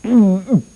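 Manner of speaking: short, high-pitched mock whimper; a small high noise of alarm; startled hum. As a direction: hum high at the sight of the bear. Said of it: "And he goes".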